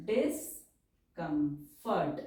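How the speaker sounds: stretched syllable by syllable; slow dictation. Shown as a demonstration. Teacher dictating a word to students, slowly. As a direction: dictate it for the pen.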